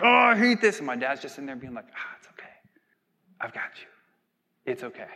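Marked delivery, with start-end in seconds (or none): 0.0-0.8 much higher, louder frustrated cry; frustrated outburst. Retold or imitated. Honking at traffic, frustrated driver's higher, louder outburst. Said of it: "I'm frustrated 'cause there's traffic". 2.0-5.1 whispered gentle reassurance; soothing whisper. Retold or imitated. Father calming his frustrated child in the car, whispered reassurance.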